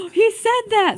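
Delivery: high, loud shocked shriek. Retold or imitated. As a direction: replay your scandalized shriek. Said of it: "shrieked with".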